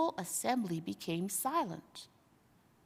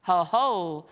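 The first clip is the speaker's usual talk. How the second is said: mock cry; 2 semitones lower, 6 dB louder than usual. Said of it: "cried the ape".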